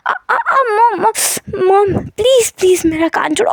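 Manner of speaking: high, pained whine with a real cry on please; whining in pain. Acted. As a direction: ear being pulled, whimpering high. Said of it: "कान को खींचते हुए".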